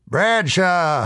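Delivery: high, drawn-out barkeep's holler. Acted. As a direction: call out across the tavern. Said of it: "hollered the barkeep".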